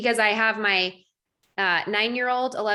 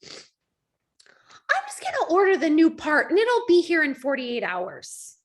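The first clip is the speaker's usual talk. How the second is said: calm husband; 4 semitones higher than usual. Retold or imitated. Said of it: "and Sean goes".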